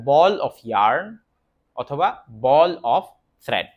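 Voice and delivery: ball of yarn spoken very slowly, word by word; slow model phrase. Shown as a demonstration. Teacher to Bangla learners, saying it slowly. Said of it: "teacher models English phrase".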